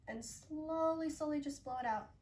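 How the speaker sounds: slow and soft. Shown as a demonstration. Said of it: "a really soft breath out".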